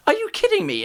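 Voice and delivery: squeaky disbelieving are you kidding; shocked high-pitched outburst; surprised. Real, not acted. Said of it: "disbelief at chat".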